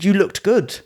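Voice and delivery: high imitated reproach; trainer's reproach. Trainer faulting his fighter's hard training, high mock reproach. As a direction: pitch it up as the complaining trainer. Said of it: "He said".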